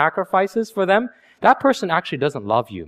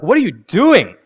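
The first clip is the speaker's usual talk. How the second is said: louder, alarmed cry; shocked shout; 3 semitones higher, 7 dB louder than usual. Acted. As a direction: yell it in disbelief. Fiance shocked in story.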